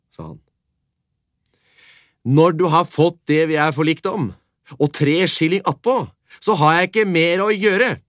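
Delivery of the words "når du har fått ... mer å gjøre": louder, much higher dismissive sheriff. Acted. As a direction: shut the matter like a stern official.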